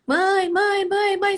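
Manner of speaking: high, loud repeated calling out; child calling. Retold or imitated. Child calling his mother who won't answer, loud and high. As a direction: call for mum again and again.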